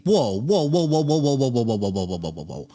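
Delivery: rapid string of whoas, sinking and fading; stunned. Real, not acted. Streamer reacting to fentanyl figure, stunned. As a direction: start at full voice, tumble down to a murmur.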